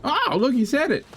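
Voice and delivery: high, bright excited reaction; delighted. Real, not acted. Delighted at Arnie line.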